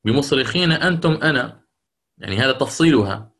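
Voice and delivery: ordinary talk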